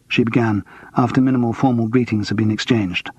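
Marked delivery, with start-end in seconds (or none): none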